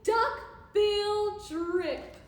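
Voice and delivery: high, loud triumphant cheer; hooted happily. A narrator's reading. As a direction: whoop it slowly like a crowd's hooray. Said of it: "They hooted".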